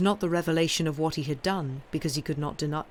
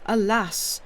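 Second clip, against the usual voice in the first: sobbing cry; 5 semitones higher, 5 dB louder than usual. Acted. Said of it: "sobs and exclamations of".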